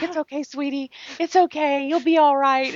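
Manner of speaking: a hint of gentle consolation; mock comforting voice. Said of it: "They're like".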